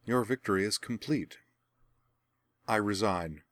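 bow out with courteous composure; quiet diplomatic resignation; cordial concession. A narrator's reading. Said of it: "as if conceding a diplomatic point".

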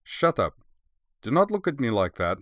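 a touch of indignant annoyance; scowling retort. A narrator's reading. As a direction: snap lightly at a pet, pitch lifted. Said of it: "gave her bare familiar a scowl and said".

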